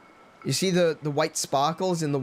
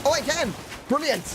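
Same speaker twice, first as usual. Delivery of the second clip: high, excited burst of joy; suddenly thrilled it works; delighted surprise; 10 semitones higher than usual. Real, not acted. Surprised success.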